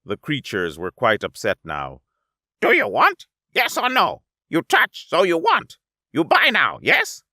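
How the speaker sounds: high, loud broken-English haggling; pushy merchant creature. Acted. Little merchant twin pushing the alien to buy. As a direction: press the sale in clipped pidgin.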